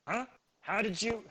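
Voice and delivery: a hobgoblin caught off guard, voice jumping up; startled hobgoblin suddenly raises his voice; surprised hobgoblin. Acted.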